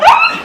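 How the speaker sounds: very high mock bride squeal; high-pitched squeal. New bride looks at her husband, high squeal.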